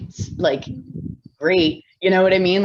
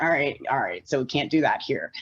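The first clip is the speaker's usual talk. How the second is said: softer, lower aside to oneself; quietly reported restraint; low-key self-correction; 5 semitones lower, 6 dB quieter than usual. Retold or imitated. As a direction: rein it in, dropping voice and volume. Reins herself in for work.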